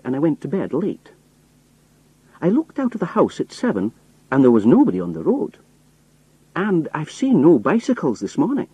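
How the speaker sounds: a Scottish workman rambling innocently; Scottish accent. Acted. Disguised narrator fools the spies in a Scottish accent. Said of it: "strong Scottish accent".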